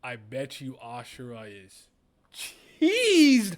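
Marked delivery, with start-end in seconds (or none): 2.8-3.6 sudden high loud shout on one word; excited shout. Real, not acted. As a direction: blurt it out at full volume. Reacting to angry character.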